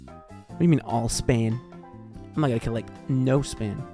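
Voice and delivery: ordinary delivery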